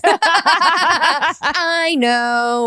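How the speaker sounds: slow, high, laughing drawl; real laughter through a knowing remark. Real, not acted. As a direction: let the laugh swallow the words.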